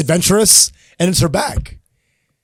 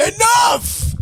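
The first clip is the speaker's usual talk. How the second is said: high exasperated shout. Real, not acted. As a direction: yell it, fed up. Exasperated at the social causes.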